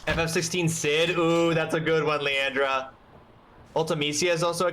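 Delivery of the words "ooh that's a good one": ordinary talk, nothing marked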